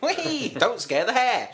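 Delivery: high, loud gleeful squeal; hammed-up excited little cry; silly quoted catchphrase. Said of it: "That's what he says".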